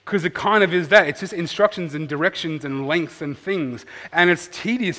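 normal talking voice

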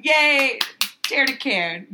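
loud, high fake cheer; mock enthusiasm. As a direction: cheer it like a cheesy ad.

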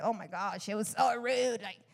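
low, lightly catty imitation; mock complaint. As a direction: imitate petty grumbling, pitched a bit down. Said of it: "sometimes we're like".

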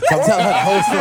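bursting with laughter as he tells it; laughing. Real, not acted. Telling the hosts the Oprah story through laughter. Excited retelling of meeting Oprah.

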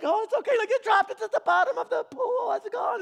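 high, light patient tone; God gently explaining. Retold or imitated. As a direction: break the news kindly and lightly. God gently breaking news.

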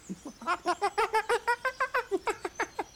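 squealing uncontrollable laugh; high burst of laughter. Acted. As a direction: crack up helplessly. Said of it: "Tidy couldn't hold himself together".

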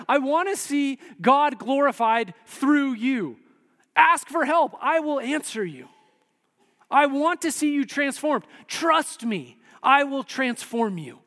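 high, loud, emphatic appeal; impassioned plea. Acted. Preacher as Jesus calling to the afraid and stuck, impassioned and high. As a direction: drive each promise home as Jesus speaking.

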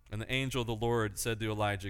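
normal talking voice

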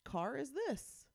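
put-on high, wondering old man. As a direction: marvel at everything like an old man. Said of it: "an older man who just comments on the colors".

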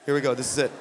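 low, muttered bracing for trouble; low, knowing aside; Jesus. Retold or imitated. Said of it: "even Jesus himself's like".

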